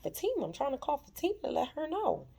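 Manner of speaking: high, panicky insistence; high mock panic. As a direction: sound desperate to reach her. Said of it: "He was like".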